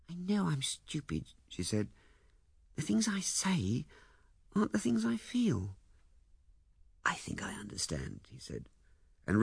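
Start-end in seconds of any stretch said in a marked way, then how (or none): 0.1-5.8 wife. Acted. Wife's voiced lines in narration. 7.0-8.2 whisper. Acted. Husband answers softly.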